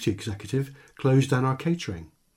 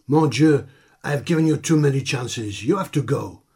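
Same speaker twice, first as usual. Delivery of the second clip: louder, hammed-up French accent; fake French accent; 2 semitones higher, 5 dB louder than usual. Ringmaster dismissing Mickey, louder in a hammy French accent. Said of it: "in that dreadful French accent".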